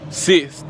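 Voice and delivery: Sixth pushed out loud as sis; tongue-tied attempt. Shown as a demonstration. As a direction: bark Sixth loudly, dropping the th. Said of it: "I'm getting tongue-tied".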